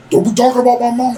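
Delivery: mock offended bro. Mocking someone offended, acted quote.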